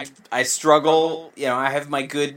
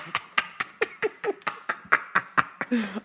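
airy laugh; 6 semitones higher, 7 dB quieter than usual. Real, not acted. Laughing inside the bit.

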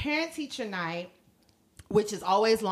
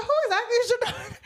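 high, rushed, laughing question; teacher flirting with a dad; mock eager question; 13 semitones higher, 5 dB louder, much faster than usual. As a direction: rush it out, barely hiding interest. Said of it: "I was like".

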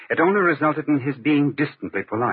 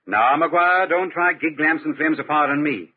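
usual speaking voice

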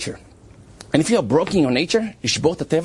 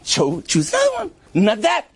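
exasperated urging; 14 semitones higher than usual. Retold or imitated. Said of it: "I said".

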